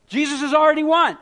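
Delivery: high, loud preaching shout; triumphant shout. Real, not acted.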